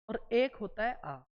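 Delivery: usual speaking voice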